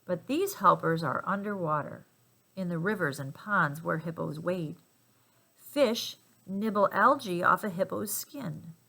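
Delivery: usual speaking voice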